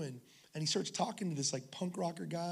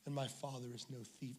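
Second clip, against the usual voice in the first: soft, hushed; 4 semitones lower, 11 dB quieter than usual. Retold or imitated. Todd's tender word to the biker.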